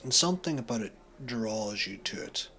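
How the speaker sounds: ordinary talk, nothing marked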